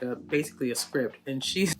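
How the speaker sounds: normal talking voice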